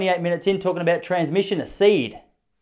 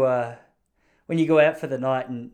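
usual speaking voice

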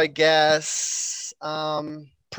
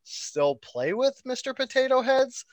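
incredulous; 7 semitones higher, 3 dB quieter than usual. Retold or imitated. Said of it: "I was all like".

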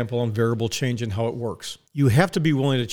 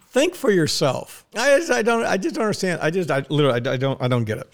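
normal talking voice

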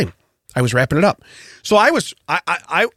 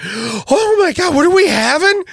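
hammed-up gasp of delight, high and loud; mock excited; 12 semitones higher, 3 dB louder than usual. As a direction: overdo the excitement about dinner.

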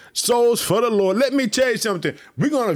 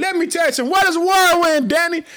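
excited preaching burst; 7 semitones higher, 3 dB louder, faster than usual. Real, not acted. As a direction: pitch jumps way up, much louder on whirlwind. Preaching climax, sudden high call.

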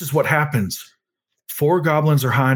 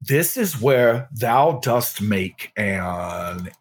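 slow, grand archaic speech; hammy stage actor; 3 semitones lower, much slower than usual. Acted. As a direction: declaim it like an old stage actor.

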